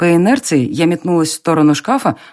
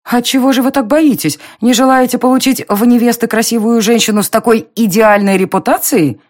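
indignant taunt; 6 semitones higher than usual. A narrator's reading. Heroine taunting Darren.

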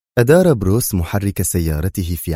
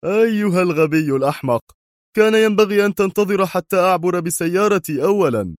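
high-pitched, indignant outburst; angry yell; 11 semitones higher than usual. Acted. Qadri scolding a driver after the crash, angry yelling. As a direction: berate the other driver furiously, pitch raised. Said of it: "صارخا في غضب".

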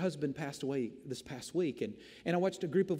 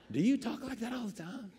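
incredulous question; 6 semitones higher than usual. Retold or imitated. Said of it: "You're like".

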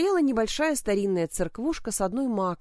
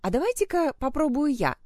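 mocking; 7 semitones higher than usual. A narrator's reading. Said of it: "насмешливо сказала дама".

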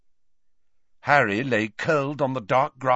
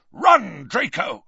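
urgent raised shout, high and loud at the start; Snape; 7 semitones higher, 4 dB louder, slower than usual. A narrator's reading. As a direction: shout a sharp warning to flee. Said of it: "Snape shouted".

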